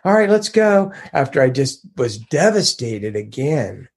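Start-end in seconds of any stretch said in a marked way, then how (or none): 0.1-0.9 higher, forced cheerful brightness over sadness; fake happy face. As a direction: put on a falsely upbeat front. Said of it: "trying to put on the happy face".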